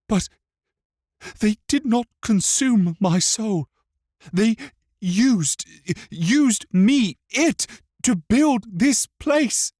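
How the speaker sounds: put-on high, loud, halting child's voice; anguished boy. A narrator's reading. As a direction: force it out in broken phrases as a wronged boy.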